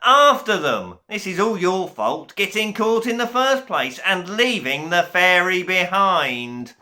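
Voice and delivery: loud, scolding tirade; angry yelling. A narrator's reading. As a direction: blame the servants as you shout. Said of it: "yelled the Duke".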